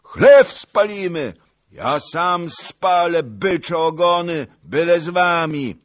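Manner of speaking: high, loud battle orders; old cossack shouting. Acted. Old cossack shouting orders at his men. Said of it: "krzyknął stary kozak".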